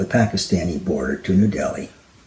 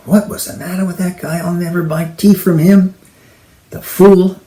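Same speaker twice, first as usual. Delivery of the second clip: mild grumbling berating; 8 semitones higher than usual. Acted. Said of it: "He's berating in his mind".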